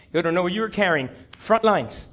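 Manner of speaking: ordinary delivery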